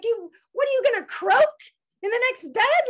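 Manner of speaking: loud, high heckle imitating dad; mock yell across to the next bed; shouting out. Daughter imitating dad heckling the roommate, shouting out. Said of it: "shouting out stupid shit".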